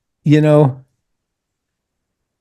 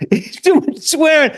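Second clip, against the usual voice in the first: laughing quote; 9 semitones higher than usual. Retold or imitated. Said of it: "He goes".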